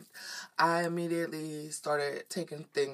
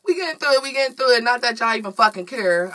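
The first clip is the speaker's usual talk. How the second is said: loud; 7 semitones higher, 12 dB louder, much faster than usual. Real, not acted. Rallying herself loudly.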